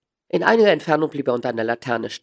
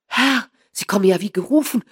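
loud, higher cry of relief; excited cry; 9 semitones higher, 7 dB louder than usual. A narrator's reading. Small man welcoming Holmes's help, calling out excitedly. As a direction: call out eagerly to a rescuer. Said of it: "rief der kleine Bursche".

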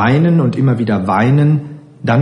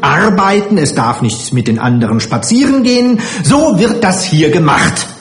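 high-pitched, self-righteous strict matron; strenge Heimleiterin, ungehalten; 7 semitones higher than usual. A narrator's reading. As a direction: lay down the rules sternly, pitch up. Matron figure in story. (strenge Heimleiterin, hoch und herrisch)

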